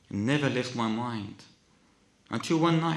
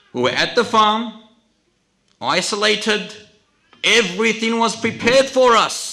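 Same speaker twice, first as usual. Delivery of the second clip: loud, raised sermon voice; forceful preaching; 11 semitones higher, 12 dB louder than usual. Real, not acted.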